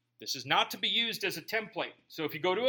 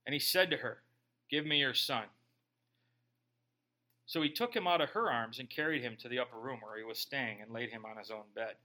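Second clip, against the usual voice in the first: measured, lower Bible-reading voice; scripture reading; 6 semitones lower, 3 dB quieter than usual. A narrator's reading. Preacher reading scripture aloud to church, measured. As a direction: read the passage steadily to the congregation.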